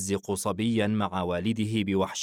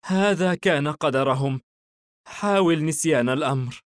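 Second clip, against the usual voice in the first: resigned mother, moderately raised voice; sighing resignation; 6 semitones higher, 5 dB louder than usual. A narrator's reading. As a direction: comfort a child while giving in to fate. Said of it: "احتضنت صغيرها بقوة وتنهدت".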